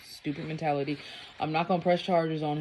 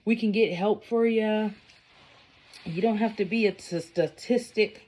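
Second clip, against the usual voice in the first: reassuring stranger; 5 semitones higher than usual. Retold or imitated. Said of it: "they're like".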